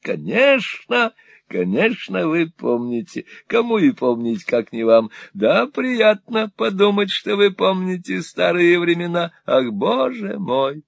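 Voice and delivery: put-on high, wheedling old miser voice; fawning old moneylender. Old usurer fawning on Ralph, high and wheedling. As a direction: simper it like a cringing usurer. Gride voiced in Nickleby reading.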